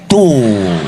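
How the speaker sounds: ordinary talk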